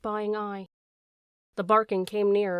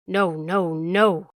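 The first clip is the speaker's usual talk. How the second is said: loud yelled refusal, last word strongest; 2 semitones lower, 6 dB louder, much slower than usual. A narrator's reading. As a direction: yell over the accusation. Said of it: "the dog yelled".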